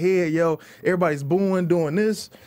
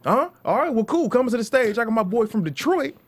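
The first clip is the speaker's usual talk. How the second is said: higher, hyped emcee intro; host hype voice; 7 semitones higher than usual. Retold or imitated. Host bringing the comic up, higher hype voice. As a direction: pump the crowd like a club host. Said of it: "host at the time was [name]".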